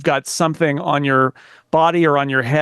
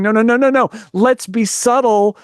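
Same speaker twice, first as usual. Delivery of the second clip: higher-pitched pleading, slowing on the key phrase; mock urgent objection; 5 semitones higher than usual. Imagined Apple staffer objecting to a big launch, mock urgent restraint. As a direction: cut in with repeated noes, then urge restraint. Voices the missing voice of caution.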